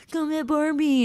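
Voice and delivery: high teasing call through the door; mocking taunt. Marines knocking on her barracks door, teasing call. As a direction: bait her like a playground bully.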